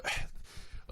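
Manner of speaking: a long fed-up sigh; exasperated sigh at the segment; weary sigh. Real, not acted. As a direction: sigh out your boredom with it. Fed up with [name] segments.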